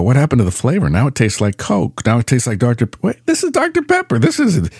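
acted dawning disbelief, pitch climbing high; puzzled surprise. Customer realises the root beer is Doctor Pepper, acted surprise. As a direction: jump up high as the mistake sinks in.

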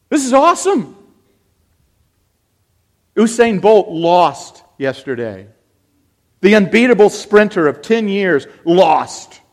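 loud, driving sermon voice, rising; fervent preaching. Acted. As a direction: cry it out to the congregation. Preaches at full force.

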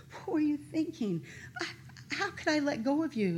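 upset questioning, as if through tears; retold tearful plea. Crying sister asks why in the tent, retold tearfully. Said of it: "And I start crying".

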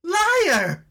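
very high furious scream. Acted. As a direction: scream it at him. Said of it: "Elna screamed".

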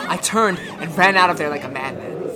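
ordinary talk

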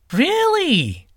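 very high, sly mock surprise; barely hide the smirk; smirking disbelief. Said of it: "failing to keep the smirk off his face".

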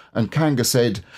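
usual speaking voice

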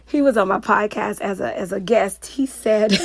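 normal talking voice

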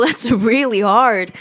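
slow, with an awkward laugh. Real, not acted. Admitting to listeners that being held is hard, laughing awkwardly. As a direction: laugh it off, embarrassed. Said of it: "I'm laughing because it's like".

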